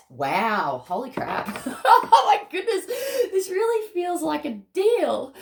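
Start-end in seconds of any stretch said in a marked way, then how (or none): 1.5-2.0 high, loud squealing laugh; squeal of laughter. Real, not acted. Stunned by the cards flipping out, a loud squealing laugh. 2.1-5.3 high, loud, amazed and moved. Real, not acted. Overwhelmed by the cards, high and loud in amazement.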